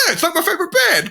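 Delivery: high, rushed excited gush; a fan spotting his favorite band, high and rushed; mock excited.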